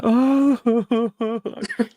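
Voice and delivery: high, loud mock sobbing; long acted-out crying howl; imitated bawling. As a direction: wail in broken sobs, acting it out. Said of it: "I started bawling".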